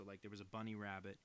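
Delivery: usual speaking voice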